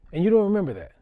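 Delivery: loud, high disbelief; incredulous. Retold or imitated. Coach stunned the ref forgot ejecting him, loud disbelief.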